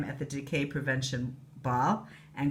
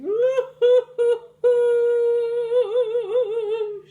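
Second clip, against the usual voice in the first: wailing sob; 9 dB louder than usual. Acted. Fairy crying.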